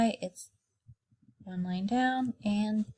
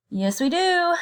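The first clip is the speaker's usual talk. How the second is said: excited yes; 9 semitones higher, 4 dB louder than usual. Real, not acted. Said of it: "answering to kindergarten".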